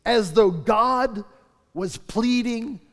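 slow, weighty pulpit delivery; slow preaching. Acted. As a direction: lean on every word, slowly.

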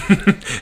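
a short high burst of laughter at his own joke. Real, not acted. Host laughing at his own award name, high laugh. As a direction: laugh out loud, pitch jumping up.

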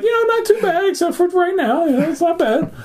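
high-pitched mock-casual reply; cheery small talk. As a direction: act out chirpy hellos over the din. Cheery retold small talk amid chaos.

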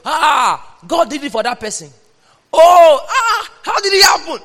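high, loud mock wonder at a miracle; mock amazed testifier. Preacher mimics an amazed believer hearing testimony, hammed-up high wonder. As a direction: ham up a gasping church member.

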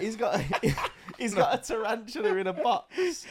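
high-pitched, telling it through laughter; real laughter breaking up the words; laughing. Real, not acted. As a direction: struggle to get the story out while laughing. Laughing through his spider anecdote.

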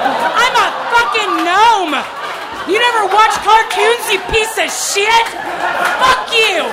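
put-on a drunk gnome losing it at a heckler; furious rant. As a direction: scream it in blind rage. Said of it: "blind with rage".

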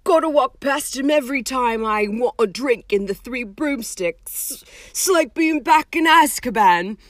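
haunted half-giant voice; Hagrid voice. A narrator's reading.

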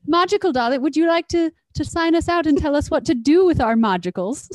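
high, loud teasing Irish lilt; playful put-on Irish voice; mock Irish accent. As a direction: egg her on in a fake Irish accent. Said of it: "It's an Irish accent".

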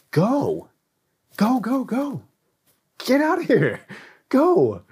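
high-pitched urgent shooing; urgent send-off. Acted. Preacher shooing the church out to go, urgent send-off. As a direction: cry them out the door, pitched well up.

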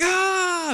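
very high, loud scream; imitated panicked yell. Guys in the back when he cranks it again, imitated scream. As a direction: burst out screaming like startled passengers. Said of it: "and same thing".

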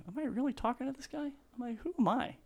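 retelling his own starstruck disbelief; astonished inner thought. Retold or imitated. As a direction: marvel that this is really happening. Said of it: "in my mind, I'm thinking".

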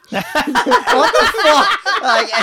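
laughing out loud; high laughing burst. Real, not acted. Laughing at own story.

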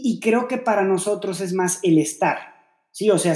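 usual speaking voice

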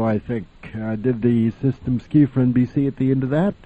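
ordinary talk, nothing marked

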